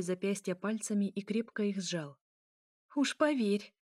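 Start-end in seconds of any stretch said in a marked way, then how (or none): 2.9-3.7 higher, tense retort through rising tears; mild angry hiss. A narrator's reading. Elya hisses at Mikhail, swallowing tears, mildly angry. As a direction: retort sharply, pitch raised, holding back tears. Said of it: "зашипела она, сглатывая подступающие слёзы".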